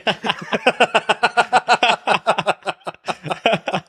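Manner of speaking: real high-pitched burst of laughter; high laughing fit. Real, not acted. Laughing at Tyson's joke.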